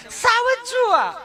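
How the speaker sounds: mock the over-educated tourist lady; prim educated lady. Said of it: "ચીપી ચીપીને બોલે". (ચીપી ચીપીને બોલતી ભણેલી બેન)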